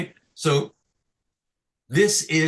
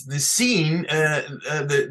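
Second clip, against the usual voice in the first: usual speaking voice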